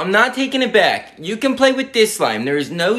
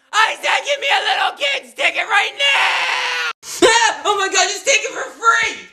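much higher screaming tantrum, slow and drawn out; furious shrieking; 13 semitones higher than usual. Acted. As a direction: screech like a kid turning into a monster. Girl outraged at adult ticket price.

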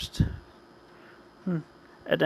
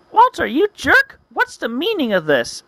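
high, loud indignant cry; hammed-up angry protest; acted outrage; 11 semitones higher, 4 dB louder than usual. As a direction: yell in shrieking-high mock fury.

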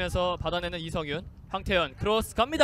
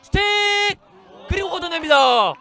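excited commentary; 9 semitones higher, 11 dB louder than usual. Acted. Live play-by-play commentary.

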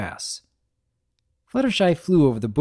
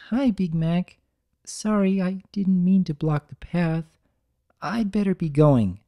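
a bit higher, shy mare; timid Fluttershy; 6 semitones higher than usual. Acted. Fluttershy greeting Big Mac, a bit higher timid mare. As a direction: apologise meekly.

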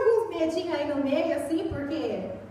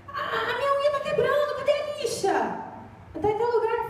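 high complaining ask; daughter asking; 9 semitones higher than usual. Retold or imitated. Daughter asks mother for a nail file, high and whiny. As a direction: whine about a broken nail, pitched up.

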